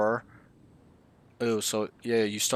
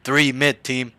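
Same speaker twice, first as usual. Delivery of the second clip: loud, sharp callout; 3 semitones higher, 8 dB louder, slower than usual. Shown as a demonstration. Coach demonstrating an exaggerated callout to his student, loud. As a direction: snap the callout out. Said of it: "I'm over-exaggerating a little bit".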